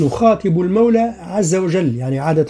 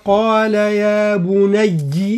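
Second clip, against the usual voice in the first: slow, higher Quranic chant; Quran recitation; 4 semitones higher, much slower than usual. Shown as a demonstration. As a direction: recite the verse with full tajweed. Recites the verse. (تلاوة مجودة بطيئة)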